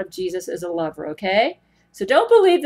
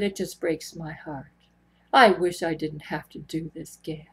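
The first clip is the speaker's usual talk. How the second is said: fake heartbroken Jesus; 5 semitones lower, 5 dB quieter than usual. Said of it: "Pretty good fake".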